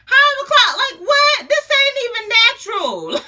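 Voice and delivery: loud, very high squawked protest; comic disbelief at full volume; incredulous outrage. Retold or imitated. Retold outrage at nurse's order.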